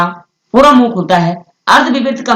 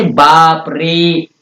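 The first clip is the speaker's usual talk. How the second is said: बाप रे drawn out very slowly, रे held long; triple-length call; much slower than usual. Shown as a demonstration. Teacher demonstrating a pluta vowel, slow drawn-out बाप रे. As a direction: stretch out बाप रे like a long exclamation. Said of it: "तिगुना समय लगता है".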